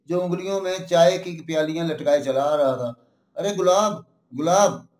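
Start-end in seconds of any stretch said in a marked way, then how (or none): 3.4-4.9 moderately raised call, falling on the name; shouting to someone. A narrator's reading. Villain spots Gulab across the studio, calling out. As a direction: hail someone spotted across the way. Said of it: "गुलाब को देखकर चिल्लाए".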